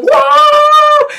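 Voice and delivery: high, loud drawn-out wail; imitated shriek of panic; mock scream. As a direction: scream like horrified parents. Parents scream during fake exorcism.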